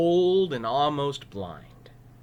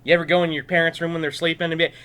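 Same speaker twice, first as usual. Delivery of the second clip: plain reading voice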